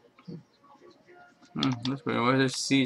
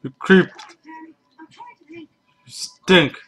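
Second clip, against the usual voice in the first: angry yelling; 13 semitones higher, 6 dB louder, slower than usual. Real, not acted. Insulting creeper after escape.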